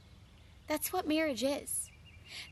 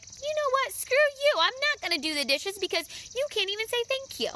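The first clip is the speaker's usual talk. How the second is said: retold spiteful outburst, pitched up; mock angry retort; 9 semitones higher than usual. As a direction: act out the fed-up wife, hammed-up. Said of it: "I take it in and I'm like".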